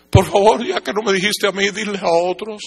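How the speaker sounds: high-pitched acted entreaty; pleading. Dead relatives begging that others be told, pleading. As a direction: beg from a place of regret.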